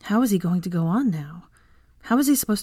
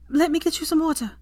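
Hermione's voice; 10 semitones higher than usual. A narrator's reading. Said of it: "Hermione tends Draco".

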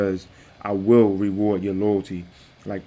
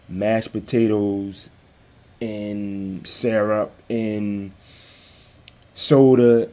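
dragged out slowly; much slower than usual. Retold or imitated. Describing how heavy walking felt.

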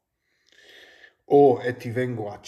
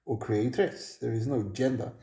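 ordinary delivery

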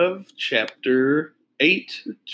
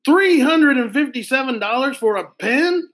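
much higher, rising outraged shout; can't believe the price, shout it up; shouted disbelief; 13 semitones higher, 4 dB louder than usual. Acted. Boy shocked at a pen's price, shouting in disbelief. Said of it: "Arlo shouted".

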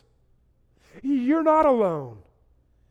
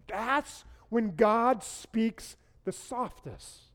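ordinary talk

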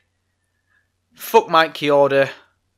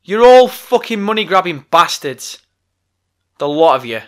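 raised, heated outburst; angry rant; 4 semitones higher, 6 dB louder than usual. Real, not acted. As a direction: let the anger spill over. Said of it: "vented a frustration".

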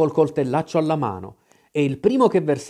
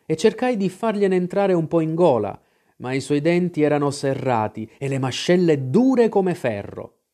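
ordinary delivery

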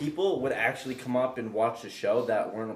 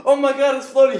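high loud astonished cry; amazed crowd; 10 semitones higher, 9 dB louder than usual. Retold or imitated. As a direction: gasp like you saw a ghost. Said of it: "and everyone goes".

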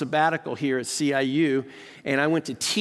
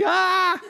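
high loud shriek of delight; excited squeal; 5 dB louder than usual. Retold or imitated. Fan who just met royalty, shrieking. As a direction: let out a starstruck scream. After meeting Prince Charles.

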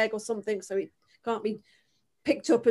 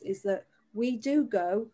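ordinary talk

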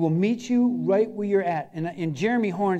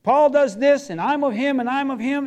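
boastful church members; 5 semitones higher, 6 dB louder than usual. Retold or imitated. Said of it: "What they were saying is".

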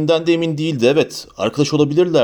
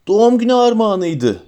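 high, loud upside-down yell; crying out; 8 semitones higher, 4 dB louder than usual. A narrator's reading. Ron hanging in the air, crying out to Harry. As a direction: shout it while spinning helplessly. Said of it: "diye haykırdı Ron".

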